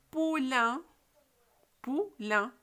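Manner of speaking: "Poulain" loud, split into pou and lain; syllable demonstration. Shown as a demonstration. Teacher tests pupils' ear for "un", loud syllabled "Poulain". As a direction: sound out "Poulain" by syllable, holding each. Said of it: "Vous entendez le son un?".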